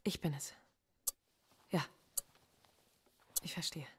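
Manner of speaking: soft, halting words to a loved one; hushed phone call. Acted. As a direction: keep it private and barely voiced.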